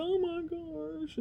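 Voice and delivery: very high slow squeal; high stretched squeal of despair to her son; mock dismay. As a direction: draw out a high squeaky oh my gosh. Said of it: "I'm like".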